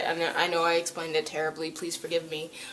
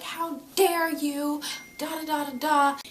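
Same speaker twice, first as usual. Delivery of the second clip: hammed-up high tirade; mock furious diamond; 9 semitones higher than usual. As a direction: ham up the outraged ruler. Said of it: "Yellow Diamond flips out".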